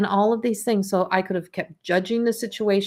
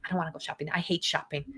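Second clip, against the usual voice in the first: grumpy refusal; 4 semitones lower, 10 dB quieter, faster than usual. Retold or imitated. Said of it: "someone says".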